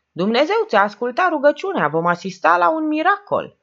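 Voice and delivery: high, loud proclamation; mock exultation. A narrator's reading. Iacobescu to the priest about his prayer, sardonic exultation. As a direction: announce a miracle with sardonic fervour.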